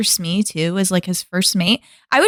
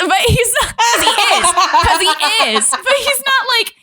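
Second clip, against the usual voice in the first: laughing; 10 semitones higher than usual. Real, not acted. Laughing while explaining.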